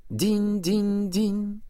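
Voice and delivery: high slow bell chime; chiming ding-ding. Acted. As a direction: sing it like a tiny bell. Chiming sound of bell bracelet.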